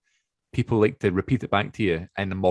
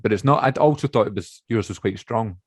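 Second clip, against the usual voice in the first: ordinary talk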